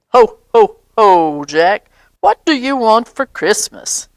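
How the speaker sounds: hearty ho ho ho, played lightly; Santa voice. Father plays Santa for Jack.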